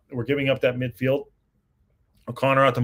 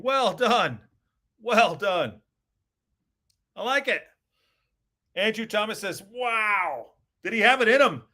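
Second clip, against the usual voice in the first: excited commentary; 8 semitones higher than usual. Real, not acted. Excited commentary after goal.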